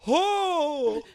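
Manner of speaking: loud, very high mimicked cry; hammed-up onlooker wail; mock bystander. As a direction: draw it out high like the gawking guy. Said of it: "that guy, like, being like".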